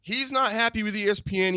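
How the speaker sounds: usual speaking voice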